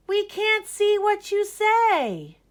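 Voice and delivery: put-on high, slow, loud childish complaint; whiny classmates. Classmates complain to Ned, whiny. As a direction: whine it like disappointed kids.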